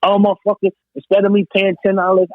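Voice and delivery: ordinary delivery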